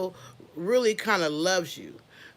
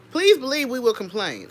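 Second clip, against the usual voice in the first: normal talking voice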